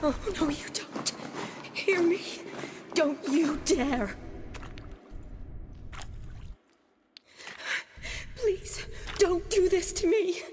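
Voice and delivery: high, strained plea to a dying patient; urgent, high-pitched pleading; desperate pleading. Acted. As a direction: beg them not to slip away. Begging a dying patient to live.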